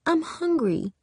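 put-on high little-girl voice. A narrator's reading. Little girl complaining to her big sister, high child voice. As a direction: pipe up as a hungry little sister. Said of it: "The little girl's voice".

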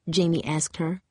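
ordinary delivery

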